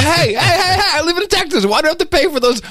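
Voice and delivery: high, whiny outraged voice; indignant Texan. Retold or imitated. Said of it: "Other people will be going".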